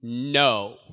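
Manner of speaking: a loud, final no; emphatic no. Shown as a demonstration. Preacher showing Jesus' answer, emphatic. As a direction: plant the word no like a wall. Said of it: "this emphatic".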